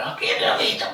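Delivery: imitating a raspy half-voiced holler of the destination; hoarse bus caller. Old bus conductor calling passengers, in a hoarse imitated voice. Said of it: "pero con una voz media ronca".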